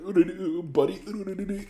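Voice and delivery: high-pitched silly nonsense jabber; mocking babble. Imitating a friend barging into the chat, mocking babble. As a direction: mimic a friend in a squeaky, taunting babble.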